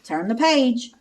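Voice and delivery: ordinary delivery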